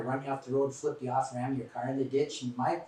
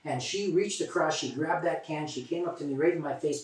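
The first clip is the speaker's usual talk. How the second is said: ordinary talk, nothing marked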